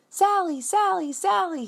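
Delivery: calling out high and loud; desperate calls into the sewer; shouting. Acted. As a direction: shout her name down the sewer. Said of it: "he shouts".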